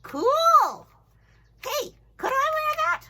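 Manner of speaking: high squeaky puppet; high playful puppet voice. Pretend. Puppet talking to teacher.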